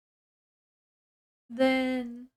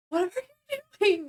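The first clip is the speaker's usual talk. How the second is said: high, fast, louder mock shriek; freaked-out outburst; 12 semitones higher, 5 dB louder, much faster than usual. Leche finds a kid in his house, freaked out. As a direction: blurt it out high and panicked. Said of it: "And he freaks out".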